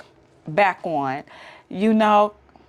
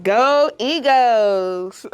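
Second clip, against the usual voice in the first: slow, cheering go Eagles; drawn-out cheer; 3 semitones higher, much slower than usual. Real, not acted. Wife cheering the Eagles' win for Philly fans, slow drawn-out cheer. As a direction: stretch go Eagles out slow like a chant.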